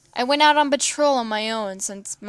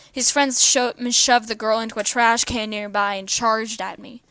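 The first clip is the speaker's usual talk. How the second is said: ordinary delivery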